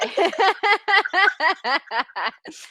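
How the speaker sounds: long, high, loud cackle; hearty laughter. Real, not acted. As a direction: keep cackling loudly and high. Host laughs after teasing Bob.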